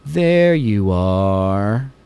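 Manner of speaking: creepy, very slow, drawn-out mock villain voice; Uncle Howdy. Retelling Uncle Howdy's interview of Bo Dallas, creepy drawn-out impression. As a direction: stretch every word out eerily, imitating a creepy figure. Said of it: "in this creepy voice is like".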